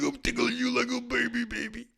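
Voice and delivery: put-on very high squeaky baby talk, fading; tickling a baby. Host mocking own lack of seriousness, high baby-tickling voice. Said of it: "And I'm over here, like".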